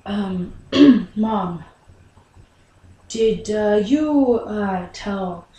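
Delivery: halting, with ums and pauses; hesitant. Acted. Son asks Mom whether she told Billy, hesitant. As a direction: feel out the question awkwardly.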